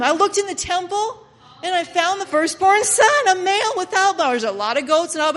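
loud, high, breakneck enthusiasm; excited announcement. Acted. Worshipper brings Dad the firstborn, loudly excited. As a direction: burst with news, pitched high and loud.

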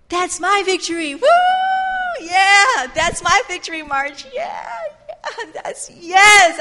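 high, loud whooping cheer; screaming in triumph. Acted. Rahab cheering the marchers from her window, screaming in triumph. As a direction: holler it out like a wild fan. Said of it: "she's probably screaming out her window".

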